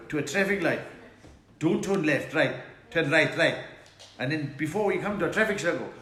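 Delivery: put-on loud, rapid-fire Durban accent. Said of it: "Give us directions in your Durban voice".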